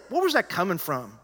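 ordinary delivery

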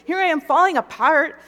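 loud, high exasperated demand; hammed-up indignation at a passive husband; mad pleading. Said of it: "I started to get more mad at him".

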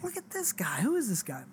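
high, soft whisper of disbelief; incredulous whisper. Retold or imitated. Sitting in the rental car with Amanda, whispering in disbelief. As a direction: hiss it to your wife so he won't hear. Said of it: "I'm like".

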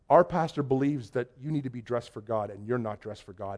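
low, quiet rebuke; imitating a solemn warning, sinking in pitch; grave disapproval. Church man tells visitor his clothes won't do, grave disapproval.

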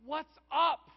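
a frustrated believer praying out loud, loud then trailing off; complaining to God. Retold or imitated. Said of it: "a conversation that kinda goes like this".

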